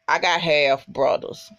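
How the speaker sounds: usual speaking voice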